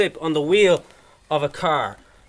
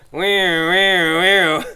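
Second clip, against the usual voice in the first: voiced siren wail, louder; imitated siren; 5 dB louder, much slower than usual. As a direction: mimic a police siren with the mouth. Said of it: "until I heard".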